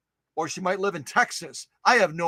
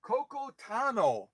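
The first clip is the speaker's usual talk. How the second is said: Coco Tano drawn out slowly and softly; 4 semitones higher, 8 dB quieter than usual. Shown as a demonstration. Host trying out a viewer's unfamiliar name, slowly. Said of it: "I have to say it really fast".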